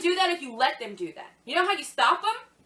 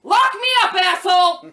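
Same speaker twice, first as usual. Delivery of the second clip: acted defiant yell; defiant shout; 3 semitones higher, 9 dB louder than usual. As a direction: shout back a dare. Acted defiant reply to Obama.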